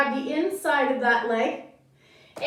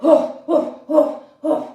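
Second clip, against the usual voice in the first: louder, grumbling woof, woof like a cross dog; a mock angry dog's woof; 7 dB louder than usual. Shown as a demonstration. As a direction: bark like an angry dog, playfully. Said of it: "Be an angry dog".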